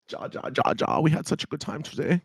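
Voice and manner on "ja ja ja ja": a hint of German accent; German ja said lightly; playful German yes; mock German. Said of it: "Why is your German accent so good".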